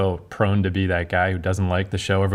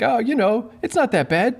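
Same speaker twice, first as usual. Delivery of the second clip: much higher, hammed-up apologetic fan; mock fan voice; 13 semitones higher than usual. Host mocks fans defending weak episodes in a high, hammed-up voice. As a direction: squeak it out like a fan making excuses.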